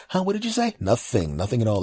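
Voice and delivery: ordinary talk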